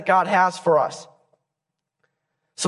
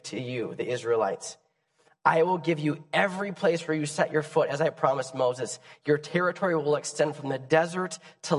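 soft, tender voice of God; 4 semitones lower, 5 dB quieter than usual. Acted. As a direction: speak gently as a loving God. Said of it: "It says this in Joshua".

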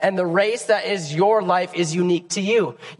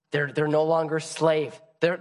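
ordinary talk

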